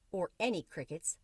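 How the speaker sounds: plain reading voice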